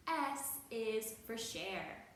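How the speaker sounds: usual speaking voice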